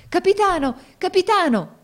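terrified passengers shout for the captain; desperate shouting. Acted. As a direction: cry out for help in panic. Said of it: "gridarono tutti insieme".

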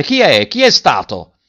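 loud, high insistent shout. A narrator's reading. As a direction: bellow the question at the crowd. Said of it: "gridavan sempre più forte".

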